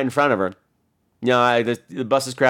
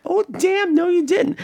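very high, scandalized squeal of words; gleeful mock shock; slower than usual. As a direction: react like a thrilled onlooker. Gleeful reaction to a telling-off.

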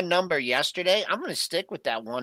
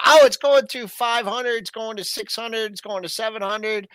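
mock hype; 6 semitones higher, 5 dB louder than usual. Mocking hype buyers.

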